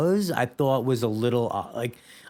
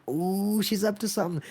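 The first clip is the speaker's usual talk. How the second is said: much higher, sly, knowing ooh; knowing suspicion; 7 semitones higher, 3 dB quieter than usual. Retold or imitated. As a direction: catch on that she's scheming. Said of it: "I'm like".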